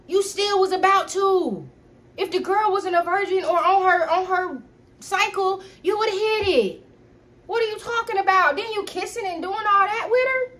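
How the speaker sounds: high, loud, accusing rant; high heated accusing. Retold or imitated. Girlfriend still accusing boyfriend, high and heated. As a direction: keep hammering him with the blame.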